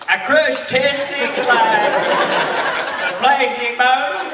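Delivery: put-on loud, drawn-out botched Russian accent; mock a hammed-up, terrible Russian accent; Norman. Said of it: "Norman goes".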